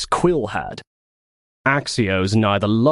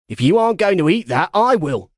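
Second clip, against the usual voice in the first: fast, louder greedy offer; eager grab; 8 semitones higher, 3 dB louder, faster than usual. Acted. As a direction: pounce on the food. Quill eyeing food.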